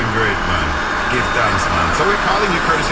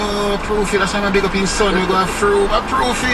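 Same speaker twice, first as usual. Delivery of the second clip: higher, eager hail of Proofy; excited retelling; 8 semitones higher than usual. Retold or imitated. Host retelling how he hails Proofy, pitched up excitedly. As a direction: greet him like an old friend. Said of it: "I say".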